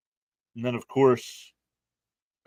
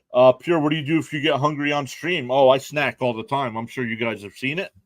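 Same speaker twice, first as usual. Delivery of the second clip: ordinary delivery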